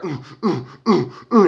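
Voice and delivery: mouthed club thump, repeated oohs; pulsing "ooh" beat; imitated background bass. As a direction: imitate party music heard down the phone. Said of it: "like, I can hear".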